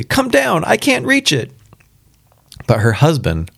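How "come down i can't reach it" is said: high, urgent call for help; loud cry. A narrator's reading. Wife calling her husband down to help, high urgent cry. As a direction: call high, without a full shout. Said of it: "she cried loudly".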